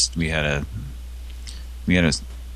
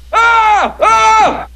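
very high loud shouted yelp; mock shout; 8 dB louder than usual. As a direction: belt it out as a scream. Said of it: "it would sound".

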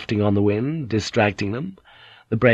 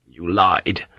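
lower, flat "lied", stubborn and bitter; flat bitter repeat; 4 semitones lower than usual. Acted. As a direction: say "lied" again low and unmoved. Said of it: "knowing that he was being unreasonable".

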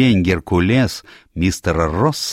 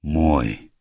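lowered, hushed single word; low voice; 2 semitones lower than usual. A narrator's reading. Financier touching his recovered cup, low voice. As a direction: drop the pitch on a possessive word. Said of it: "сказал низким голосом".

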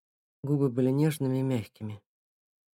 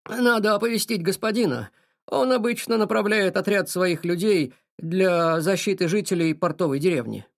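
urgent servant voice; 5 semitones higher, 5 dB louder than usual. Acted. Servant voiced louder, higher.

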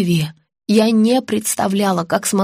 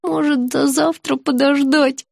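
slow, higher, plaintive moan; 5 semitones higher, slower than usual. A narrator's reading. Patient trying to put off the dentist, moaning. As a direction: moan it reluctantly. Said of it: "простонала я".

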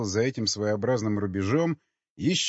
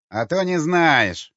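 высоко, громко, протяжно, с издёвкой; подколи, растягивая слова; с усмешкой; 7 semitones higher, 7 dB louder, slower than usual. Acted. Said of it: "усмехнулся строгий голос". (насмешливое недоверие)

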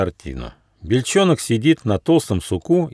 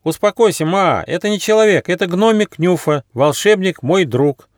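high, urgent reassurance; high-pitched excited character cry; Ryzh crying out; 9 semitones higher, 3 dB louder than usual. A narrator's reading. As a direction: call out high to calm a scared friend. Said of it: "закричал Рыж".

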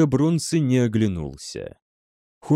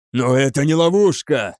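louder, emphatic denial; raised-voice protest; shouted protest; 3 semitones higher, 5 dB louder than usual. A narrator's reading. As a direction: cry out in objection. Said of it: "крикнул Грамматикус".

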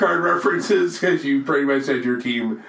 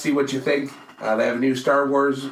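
normal talking voice

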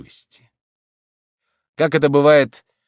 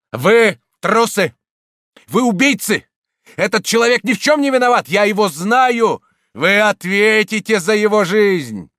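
high, loud threatening outburst; indignant furious shout; 11 semitones higher, 6 dB louder than usual. A narrator's reading. Priest denouncing the murderous crowd, furious shout. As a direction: thunder at the cowards with outrage. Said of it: "возмущённый, угрожающий, закричал".

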